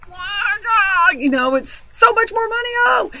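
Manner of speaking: high, loud exasperated cry; exasperated protest. Retold or imitated. Wife protesting as husband turns down a bigger session. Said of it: "And I'm over there going".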